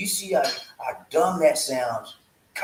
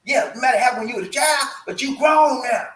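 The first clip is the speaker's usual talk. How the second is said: real shout, higher and louder, last words slowed; yelling; 7 semitones higher, 4 dB louder than usual. Real, not acted. Preacher yells at listeners blaming parents, real shout. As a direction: bursts into a yell at the grown-ups. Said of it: "I didn't mean to yell at you".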